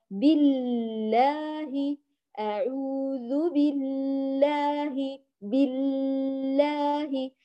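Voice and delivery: very slow بالله, the doubled l dragged out long; over-stretched shadda. Shown as a demonstration. Teacher mimics a common mistake, dragging the doubled lam. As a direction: hold the l of بالله far too long, "billllaahi". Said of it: "يمطط الشدة".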